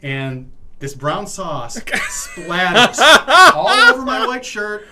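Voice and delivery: high, loud, laughing outrage; loud, high, incredulous telling; excited. Real, not acted. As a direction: build it up big as the sauce flies. Sauce splattering story climax.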